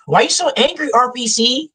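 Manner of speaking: high, whiny accusation; imitating a coworker calling him angry; mock whiny coworker. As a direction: whine it like a startled coworker. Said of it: "coworkers questioning him".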